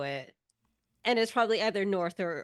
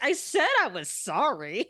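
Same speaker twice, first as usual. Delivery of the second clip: defensive; 3 semitones higher than usual. Acted. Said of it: "He's just like".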